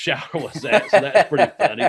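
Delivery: talking through a laugh. Real, not acted. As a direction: let real laughter break into the words. Laughs at own snake story.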